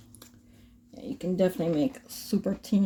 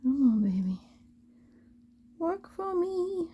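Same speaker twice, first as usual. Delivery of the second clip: coaxing; 9 semitones higher than usual. Real, not acted. Coaxing the tool.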